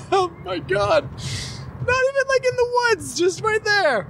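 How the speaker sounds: high shocked disbelief. Real, not acted. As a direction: squeal it out in disbelief. Shocked at man peeing roadside.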